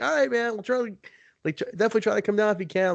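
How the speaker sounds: high, fast friendly mock voice; imitating Jerry. Retelling Jerry's phone call to friends, high fast mimicry. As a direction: rattle it off in a high chummy voice. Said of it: "he's like".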